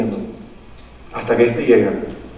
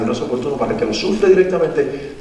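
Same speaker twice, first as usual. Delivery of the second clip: ordinary delivery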